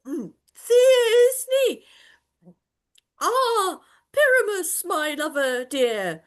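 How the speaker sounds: high, slow hammed-up falsetto maiden; falsetto lady voice. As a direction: squeak like a lovesick lady. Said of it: "speak in a monstrous little voice".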